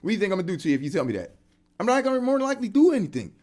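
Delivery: high, loud goading; high, loud mocking dare; taunting. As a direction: egg him on to just say it. Taunting the hater.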